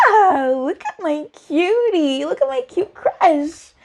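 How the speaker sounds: high, slow swooning coo; hammed-up lovestruck squeal; gushing over crush. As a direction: coo adoringly, stretching the words out.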